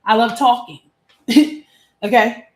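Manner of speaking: cheerful sassy boast; playful excitement. Real, not acted. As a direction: brag happily about loving to talk. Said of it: "I love yapping my mouth".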